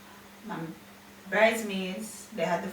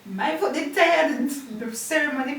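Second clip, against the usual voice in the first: loud complaint; 5 semitones higher, 8 dB louder than usual. Real, not acted. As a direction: moan it out, leaning on tired. Complaining about sore feet after wedding.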